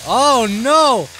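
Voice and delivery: high, loud swooping cry; loud exclaimed dismay. Real, not acted. Player reacting to the game, loud dismay.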